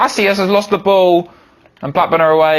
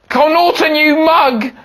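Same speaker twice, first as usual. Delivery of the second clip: higher, frustrated jab at the player; exasperated outburst; 8 semitones higher than usual. Real, not acted. Fan yelling at a careless player, exasperated. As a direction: scold the player who lost it. Player loses ball in match.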